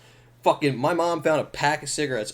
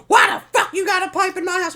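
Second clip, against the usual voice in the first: high, loud mock mom yell; mom shouting; 5 dB louder than usual. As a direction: bellow it like an angry mother. Mom voiced yelling, retold.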